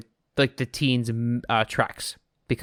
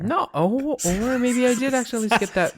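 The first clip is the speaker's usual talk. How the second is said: surprised; 11 semitones higher than usual. Real, not acted. Surprised realization, high far voice.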